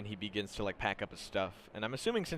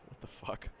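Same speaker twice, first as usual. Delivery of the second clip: under the breath, all air; whispered disbelief; 10 dB quieter than usual. Acted. Voicing a worried look at a friend, whispered. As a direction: whisper it, baffled.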